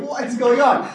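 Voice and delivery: amazed the friends vanished; imitated disbelief; mock bewilderment. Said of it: "he's like".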